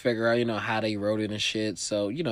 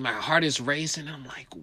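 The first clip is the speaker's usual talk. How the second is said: usual speaking voice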